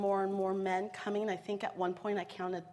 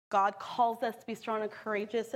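ordinary delivery

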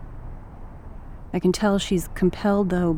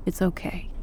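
soft reassurance; 8 dB quieter than usual. Acted. Dad soothing softly.

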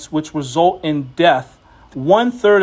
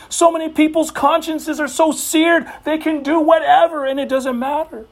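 higher-pitched sermon build, pressing each example; fiery preaching; 6 semitones higher than usual. Real, not acted. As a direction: pile up the sins like a preacher. Preaching against seared consciences.

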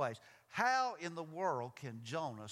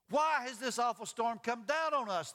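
higher, insistent question in narration; demanding; 8 semitones higher, 6 dB louder than usual. A narrator's reading. Sailors confronting Jonah over the storm, demanding. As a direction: demand an answer as the frightened crew. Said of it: "they demanded".

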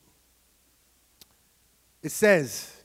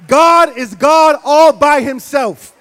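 slow, loud, high shout; preached shout; 6 semitones higher, 14 dB louder, slower than usual. Acted. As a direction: hammer the climax home slowly. Climax of the sermon.

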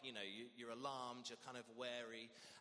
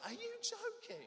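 high, incredulous squeak of a question; disbelief. Real, not acted. Soaked lifeguard watching the girl swim, squeaky disbelief. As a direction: say it in comic disbelief.

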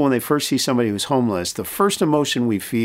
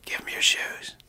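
hushed, whispered nudge; little inner voice; 8 dB quieter than usual. Retold or imitated. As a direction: whisper it like a quiet prompting from inside. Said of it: "I hear this little voice".

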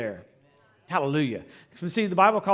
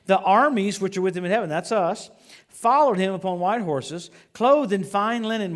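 preaching; 2 semitones higher, 6 dB louder than usual. Acted. Reading scripture with force.

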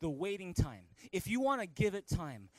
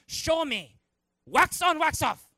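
high, loud command; hammed-up master shouting a drill; Mister Miyagi; 8 semitones higher, 10 dB louder, slower than usual. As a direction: fire it off as a sudden martial arts order. Acting Miyagi demanding a demonstration.

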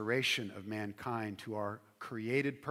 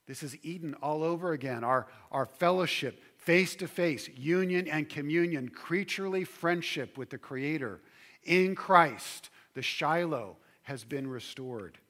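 much louder and higher, full-force preaching; preaching climax; 5 semitones higher, 8 dB louder than usual. Acted. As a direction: ring out the climax.

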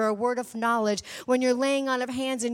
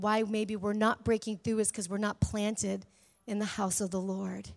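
ordinary talk

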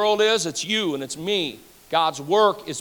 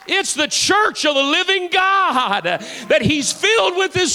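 preaching at full force; 4 semitones higher than usual. Acted. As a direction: build it higher with each "Look at them now". Preacher voices God, builds again.

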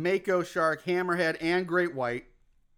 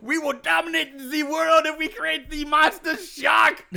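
put-on hammy German villain accent, loud and high; 8 semitones higher, 5 dB louder than usual. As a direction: bark it out in a cartoon German accent. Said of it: "your attempt at German accent with a Z".

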